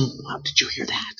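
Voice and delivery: hushed, breathy whisper to a neighbour. Retold or imitated. Onlooker in the crowd murmuring to a neighbour, whispered. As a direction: whisper it as if passing gossip along a pew.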